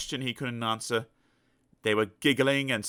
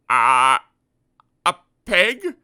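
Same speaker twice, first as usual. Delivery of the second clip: loud guess jumping higher; a muddled man guessing; unsure Mister Dizzy; 6 semitones higher, 11 dB louder, much slower than usual. Acted. Mister Dizzy guessing wrong to the elephant, puzzled.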